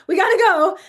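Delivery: mock impatient parent; dismissive parent. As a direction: hurry the kid along. Rushed parent.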